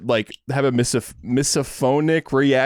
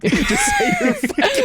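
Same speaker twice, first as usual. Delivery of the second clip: laughing; 3 dB louder, slower than usual. Real, not acted. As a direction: quote dissolving into high laughter. Cracking up while quoting.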